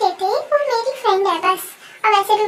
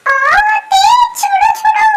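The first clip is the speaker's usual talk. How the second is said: high yelping cry to let go; pained shout; 12 semitones higher, 8 dB louder than usual. Acted. Husband bitten by his wife, shouting in pain. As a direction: shriek it as the bite hurts.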